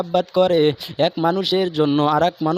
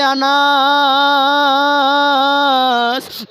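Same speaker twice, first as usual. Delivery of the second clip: high, very long-held chanted cry of the name; soaring melodic call; mother calling out; 7 semitones higher, 4 dB louder, much slower than usual. Acted. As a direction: hold the last vowel for seconds. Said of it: "মা ডাক দিয়ে বলে".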